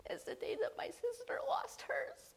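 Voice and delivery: high, breaking whisper; tearful whisper. Real, not acted. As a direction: voice cracking into a whisper. Telling of her sister's death.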